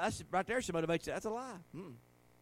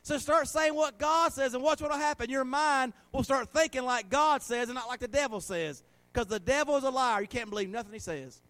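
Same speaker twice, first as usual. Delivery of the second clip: forceful preaching; 3 semitones higher, 5 dB louder than usual. Real, not acted. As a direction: drive it home to the congregation.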